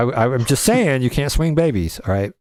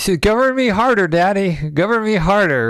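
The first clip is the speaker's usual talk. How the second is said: mock plea for more government; 7 semitones higher than usual. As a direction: beg to be governed harder, hammed up. Mocking her plea for more rules.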